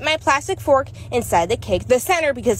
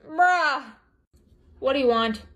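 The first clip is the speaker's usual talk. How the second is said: normal talking voice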